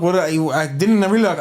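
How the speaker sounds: ordinary delivery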